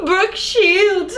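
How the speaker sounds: high-pitched cry through real laughter; laughing exclamation. Real, not acted. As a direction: repeat it in amused disbelief, laughing. Repeats the catcall amid laughter.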